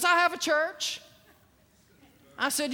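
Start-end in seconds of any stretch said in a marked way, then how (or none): 0.0-1.0 high, puffed-up boast; self-important, raised-pitch retort; arrogant. Retold or imitated. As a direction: imitate a cocky man bragging. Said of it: "he's, he's all arrogant".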